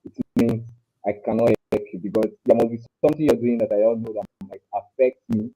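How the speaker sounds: ordinary talk, nothing marked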